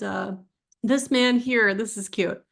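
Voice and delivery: ordinary delivery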